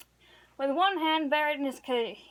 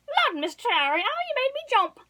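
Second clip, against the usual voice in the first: high, squealing mock fright; startled girl; 12 semitones higher, 5 dB louder than usual.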